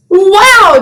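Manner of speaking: high breathy wow of wonder; amazed gasp. Acted. Reading to children, gasping at the bee sting. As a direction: gasp in delight for the kids.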